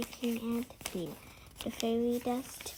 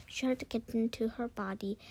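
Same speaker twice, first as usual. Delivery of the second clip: usual speaking voice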